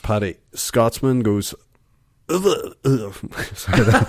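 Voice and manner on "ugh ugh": garbled attempt at a Scottish accent; false start on a bad Scottish accent; failed Scottish accent. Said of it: "I was gonna try to do an accent".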